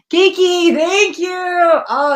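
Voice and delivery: loud, high squeal of thanks; excited. Real, not acted. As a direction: burst out with delighted gratitude. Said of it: "I was so excited".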